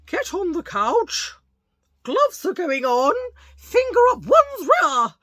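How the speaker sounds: high, loud hammed-up alarm; mock alarm. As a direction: shriek through the retold exam. Dramatised doctor's exam.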